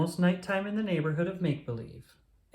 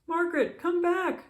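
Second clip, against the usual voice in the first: calling out; 4 dB louder than usual. A narrator's reading. Daniel calling sister back.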